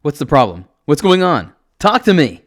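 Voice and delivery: loud, high, desperate shout. A narrator's reading. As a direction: shout to get a reply from him. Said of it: "I shout, trying to get him to say anything".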